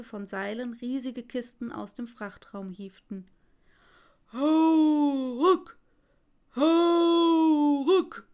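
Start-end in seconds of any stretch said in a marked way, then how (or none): 4.3-8.3 loud, higher heave-ho chant; sailors calling out. Acted. Sailors shout with every crate they haul up. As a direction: shout it like men hauling crates. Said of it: "riefen die Seemänner".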